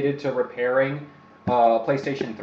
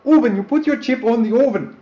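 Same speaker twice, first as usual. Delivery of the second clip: high, loud mock German accent; 8 semitones higher, 5 dB louder than usual. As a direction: ham it up as a German YouTuber. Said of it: "Now he's German".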